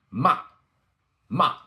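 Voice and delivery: MA short and loud, falling from high; fourth tone. Shown as a demonstration. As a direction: chop MA down like a karate chop. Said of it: "And our fourth tone".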